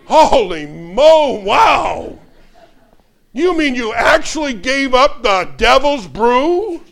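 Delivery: slow, loud, high hammed-up astonishment; a preacher's mock-astonished cry; mock amazement. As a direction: ham up the wonder for the crowd. Mock amazement at giving up drink.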